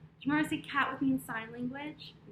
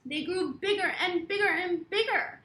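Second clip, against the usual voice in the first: loud and high, swelling with each bigger; excited building; 6 semitones higher, 8 dB louder than usual. A narrator's reading. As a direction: grow bigger with every word. Storytime build, kids.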